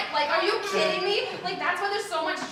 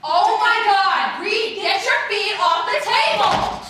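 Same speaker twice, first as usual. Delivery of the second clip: loud, slow, higher scolding at Reed; scolding yell; 5 semitones higher, 5 dB louder, slower than usual. Acted. Girl yelling at Reed to get his feet off the table, loud and slow. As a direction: yell it slowly like a fed-up mom.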